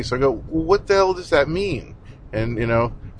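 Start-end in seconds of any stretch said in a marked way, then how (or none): 0.5-1.9 higher-pitched, retold exasperation; exasperated retort. Conference speaker retorts to the organizer on money, exasperated. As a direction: push the question up in exasperation, retelling it. Retelling his fed-up phone retort.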